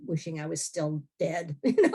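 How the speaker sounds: ordinary talk, nothing marked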